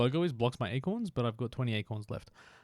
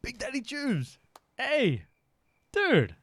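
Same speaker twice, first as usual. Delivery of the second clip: high-pitched, thrilled call-out; excited greeting; 12 semitones higher, 4 dB louder, slower than usual. Real, not acted. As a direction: hail a friend you've just spotted, voice leaping up. Spots a known player, greets him.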